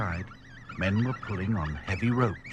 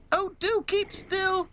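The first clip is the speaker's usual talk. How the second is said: very high, slow plea; high-voiced doll begging; pleading; slower than usual. A narrator's reading. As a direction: plead in exasperation, pitched right up. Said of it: "pleaded Dotty".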